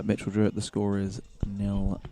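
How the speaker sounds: ordinary talk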